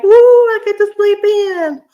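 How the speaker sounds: high, loud cheer of delight; mock-gleeful cheer about sleeping in; gleeful whoop. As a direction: whoop like school's been cancelled. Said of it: "it's a double-edged sword".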